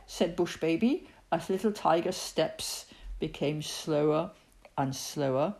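usual speaking voice

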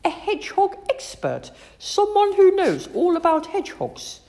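high-pitched, keen schoolgirl; excited child setting Mum straight; eager explaining. A narrator's reading. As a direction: explain it proudly, pitched right up. Girl eagerly explains to her mum.

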